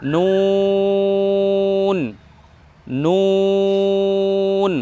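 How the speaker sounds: নুন held long with rounded lips; slow, round-lipped নুন. Shown as a demonstration. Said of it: "দুই ঠোঁট গোল করে উচ্চারণ করব".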